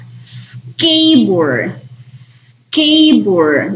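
"skateboard" said slowly, starting straight on the S sound; teacher shows that "skateboard" starts with a bare S. Shown as a demonstration. Teacher models "skateboard" slowly, no E before the S. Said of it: "Es el sonido de la S".

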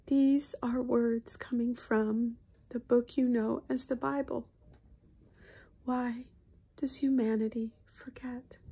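solemn, half-whispered spirit speaking through her; hushed, tender channeled voice; channeled spirit collective. Acted. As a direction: speak as a gentle otherworldly collective.

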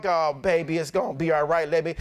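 a hint of a soothing mother figure; mock comforting. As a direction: croon the comfort she never gave. Imagined comforting pastor's wife.

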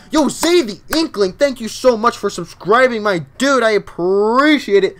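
loud, high, excited thanks; hyped shout-out. Real, not acted. Streamer thanks a new subscriber, hyped shout-out.

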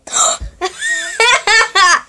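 gasp, then burst out laughing high; startled gasp. Real, not acted. Friend about to pull earring through.

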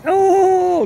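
very high, loud delighted squeal; excited squeal. Real, not acted. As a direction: squeal at the puppy jumping up. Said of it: "look at me, reinforcing her jumping up".

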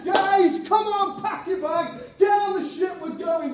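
loud, high, urgent call to the team; rallying shout. Acted. Paul calling his companions to the ship, loud and urgent. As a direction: fire up the lads to move out.

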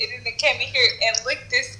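ordinary talk

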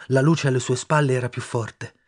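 usual speaking voice

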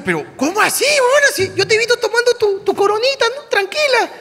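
high-pitched, disbelieving protest; acted exasperated disbelief; incredulous. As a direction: challenge her as if it can't be true. Acted disbelieving questioning of sister-in-law.

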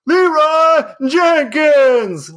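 long, high, loud call of the name; yelling after a runaway dog. Pretend. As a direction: holler it down the street after the dog. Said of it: "and be yelling".

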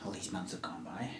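hushed, breathy whisper of long loneliness; stage whisper. Acted. Last survivor whispering to the stranger. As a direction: whisper it to yourself, haunted.

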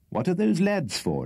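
abrupt question. Acted. Pickwick, alarmed, questions the host about the climbing boys abruptly. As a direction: blurt it out in alarm. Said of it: "inquired Mister Pickwick abruptly".

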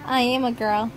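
ordinary talk

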